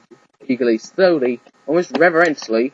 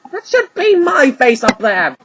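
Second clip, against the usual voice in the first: higher, louder and slow, seething; fuming; 9 semitones higher, 4 dB louder, slower than usual. Acted. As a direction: grumble with jealous heat. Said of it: "Weasel fumed".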